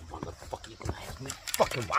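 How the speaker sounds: hushed, voiceless, seething whisper; menacing whisper. Acted. Ranting at viewers who ask why, in a seething whisper. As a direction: breathe it out low like a threat. Mock rant, whispered first take.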